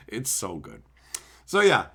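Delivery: ordinary delivery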